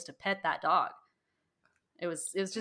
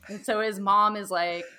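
ordinary delivery

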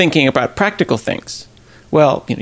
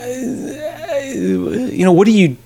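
higher, cracking with held-back tears; voice breaking; 8 semitones higher, much slower than usual. Real, not acted. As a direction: let the voice crack on it. Emotional pause about dying father.

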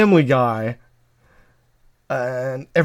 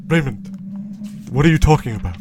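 Robert Barone. Acted. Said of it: "Robert Barone".

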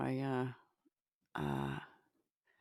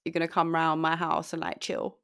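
put-on louder London rude boy accent, casual; 3 semitones higher, 9 dB louder than usual. Shown as a demonstration. Showing an American friend a London rude boy accent, louder. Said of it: "a rude boy accent".

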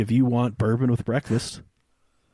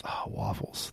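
dreamy whisper; 5 semitones lower, 13 dB quieter, slower than usual. Real, not acted. Longing for waffles.

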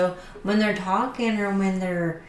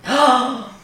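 mock gasp; 5 semitones higher, 6 dB louder than usual. Real, not acted. Shock at Disney losing out.

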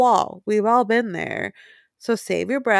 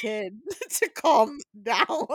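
slow words broken up by laughter; laughing; 5 semitones higher, slower than usual. Real, not acted. Retelling a funny TikTok to a friend, laughing through it. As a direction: crack up while getting the words out.

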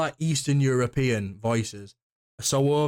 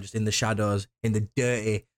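ordinary talk

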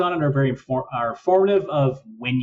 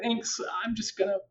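higher, small, awkward mock reply; awkward retreat; 5 semitones higher than usual. Actor answers the tactless customer, awkward and deflated. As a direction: back out meekly with a little laugh.

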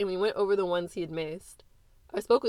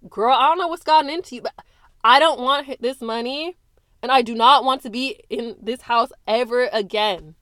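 loud, high, rushing angry rant; furious outburst; 4 semitones higher, 9 dB louder than usual. Acted. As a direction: let it pour out in rage. Daughter's quarrel with mother.

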